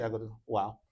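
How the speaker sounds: ordinary delivery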